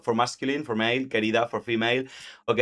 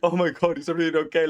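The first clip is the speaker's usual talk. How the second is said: higher, fussing mock concern; mock worried; 6 semitones higher, much faster than usual. Teacher mimicking the anxious companion, mock worry. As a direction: ham up the worry. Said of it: "all worried about Dante".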